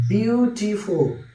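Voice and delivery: Beautiful slowly, split into three beats; বিউ-টি-ফুল, stretching out each syllable. Shown as a demonstration. Teacher showing students the syllables in beautiful, broken up slowly. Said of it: "beautiful তিনবার আমাকে থামতে হচ্ছে".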